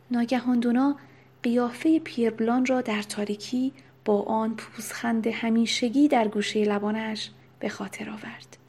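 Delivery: usual speaking voice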